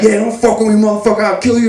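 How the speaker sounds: imitating an agitated brother's threat; retold threat. Brother warning him after the punch, threatening.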